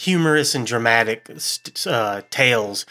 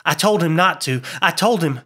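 higher, agitated, choked-up protest; suffocating voice; 6 semitones higher than usual. A narrator's reading. Summers about to faint by the body, agitated choked voice. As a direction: repeat it in rising distress, breaking off. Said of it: "he said in a suffocating voice".

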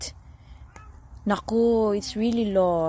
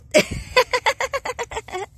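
high cackling laugh; rapid, high bursts of laughter; real laugh; 12 semitones higher, 3 dB louder than usual. Real, not acted. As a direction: cackle with amusement. Laughing at the cat's rear.

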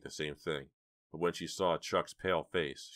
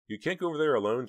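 urgent; 9 semitones higher, 7 dB louder, faster than usual. Acted. Jade insists to Chuck.

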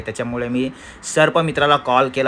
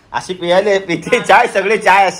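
mock imitation; 6 semitones higher, 5 dB louder than usual. Real, not acted. Mocking wife's tea drinking.

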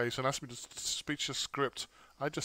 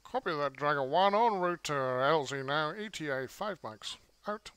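radio callout; 2 semitones higher than usual. Shown as a demonstration. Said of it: "if I give you the transcript".

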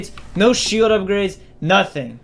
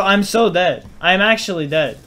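usual speaking voice

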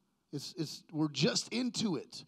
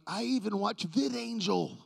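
higher-pitched, hammed-up self-justifying protest; mock pleading; 5 semitones higher than usual. Man defending his TV watching to God, mock pleading. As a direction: offer a feeble excuse as a comic plea, voice lifted. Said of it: "I'm trying to plead my case to God".

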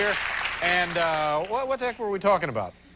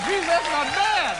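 higher, slow, impressed; drawn-out admiring remark; slow amused admiration; 10 semitones higher, slower than usual. Real, not acted. Admiring the joke.

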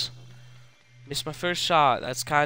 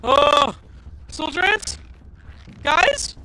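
calling for help; 12 semitones higher than usual. Real, not acted. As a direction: summon the soldier ants. Pleading with ants.

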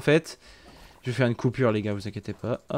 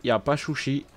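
souci said as chouchi in a put-on Chinese accent; lightly imitating a Chinese accent on chouchi; mock Chinese accent; 6 dB quieter than usual. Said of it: "Comme les Chinois là".

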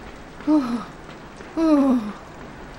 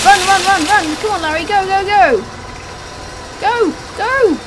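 loud frantic urging to run; being chased; 5 semitones higher, 7 dB louder than usual. Real, not acted. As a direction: yell go as the worm closes in.

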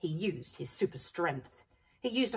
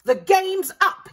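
much louder, higher, slow yell; triumphant yell; 12 semitones higher, 13 dB louder, slower than usual. A narrator's reading. Sue Potato yelling at the cornered pea. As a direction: bellow it in triumph. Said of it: "yelled Sue Potato".

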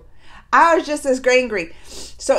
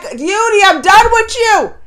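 raised, higher-pitched mock telling-off; fed-up scolding; 5 semitones higher, 7 dB louder than usual. Viewer retelling how she gave up on Yudi, loud and fed up. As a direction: scold him loudly, totally done with him.

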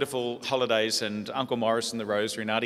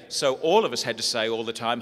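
usual speaking voice

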